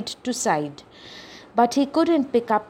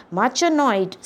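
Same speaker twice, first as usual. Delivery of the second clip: ordinary delivery, nothing marked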